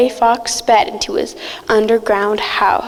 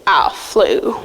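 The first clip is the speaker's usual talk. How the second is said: ordinary delivery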